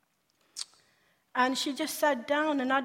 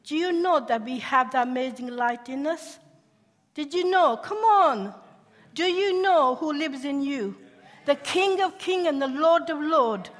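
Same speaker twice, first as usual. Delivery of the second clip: louder, higher, full-force preaching; rousing preaching; 3 semitones higher, 7 dB louder than usual. Real, not acted. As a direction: stir the congregation up. Preaching to the congregation.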